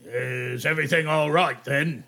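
put-on lightly accented goblin guard; a hint of a goblin asking warily. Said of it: "they're like".

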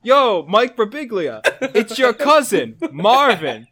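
hammed-up cousin Marvin on the phone; prank caller. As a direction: pitch the cousin's crazy comedy. Fake phone call bit.

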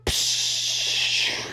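long voiceless "psh"; hissed rushing noise; whooshing jump. Acted. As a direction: imitate a dive through the air. Imitates jumping in.

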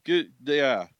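lightly voiced CB trucker; a hint of trucker radio talk; trucker lingo. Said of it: "I would love to hear Brandon be like".

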